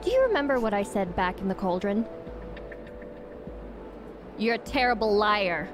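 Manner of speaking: ordinary talk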